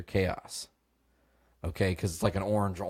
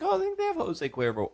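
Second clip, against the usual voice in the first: high mocking sing-song; sarcastic high voice. Storyteller mocking his own excuse to the roommate, high and sing-song. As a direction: brush off the request with a mock-innocent lilt. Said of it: "And I was like".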